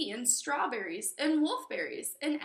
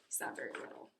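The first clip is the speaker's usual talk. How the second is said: whispered aside; 14 dB quieter, much faster than usual. Real, not acted.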